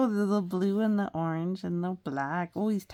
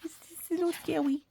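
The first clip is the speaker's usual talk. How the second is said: high, loud, fast little worried cat; squeaky fretful cat voice; timid cat voice; 8 semitones higher, 3 dB louder, faster than usual. Pretend. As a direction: play a nervous kitty, much higher. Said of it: "They're like".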